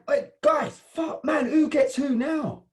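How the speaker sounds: high, loud yell in a brawl; shouting. Acted. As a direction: yell over the fight.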